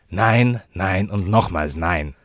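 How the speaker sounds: loud, emphatic refusal; firm, resolute. A narrator's reading. Uncle denying it to the lady, firm and loud. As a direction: hammer out each no with determination. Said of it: "antwortete Gabriel fest und entschlossen".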